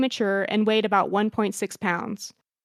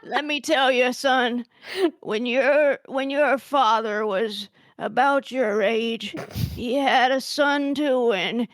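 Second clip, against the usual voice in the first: put-on old lady voice; 3 semitones higher, slower than usual. Shown as a demonstration. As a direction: preach it like a nosy grandma. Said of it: "eerily good".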